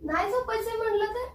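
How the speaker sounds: high-pitched put-on defiance; stubborn refusal. Wife pranking husband by refusing the bed, mock stubborn.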